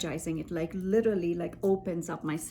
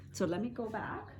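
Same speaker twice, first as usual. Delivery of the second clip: ordinary delivery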